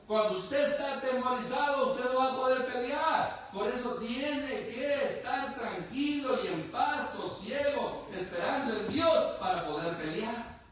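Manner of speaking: a preacher raising his voice over the fearful Philistines; preaching at full force. Acted. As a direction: drive the point home to the congregation. Preacher exhorts congregation.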